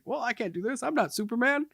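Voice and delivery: imitating movie character. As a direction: put on a high, protesting voice. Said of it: "he's like".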